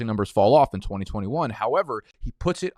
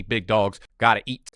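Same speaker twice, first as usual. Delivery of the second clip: usual speaking voice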